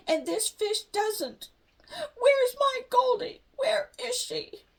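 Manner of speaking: high, slow, teary pleading; tearful. A narrator's reading. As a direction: choke it out through tears. Sister crying over her fish.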